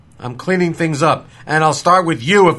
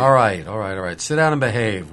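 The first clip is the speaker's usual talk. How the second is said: calming down; 6 semitones lower, 3 dB quieter than usual. Acted. Sheriff calming the scene.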